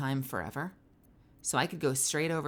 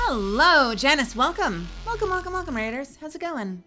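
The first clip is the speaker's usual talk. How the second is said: high, loud showman welcome; mock host welcome; 4 semitones higher, 5 dB louder than usual. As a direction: greet them like a game show host. Hosting greeting to NPC.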